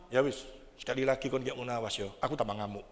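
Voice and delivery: low, soft mock threat to the household; a quiet grumbled warning, lightly acted; imitating the angry man. Man threatening his household, mild mock warning. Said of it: "Nek dia sudah gitu".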